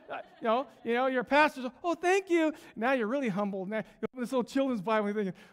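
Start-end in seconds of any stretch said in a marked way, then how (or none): 1.8-2.5 high, loud, overdone thanks; feigned gratitude. Acted. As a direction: gush politely to cover dismay. Pastor politely accepts a children's Bible.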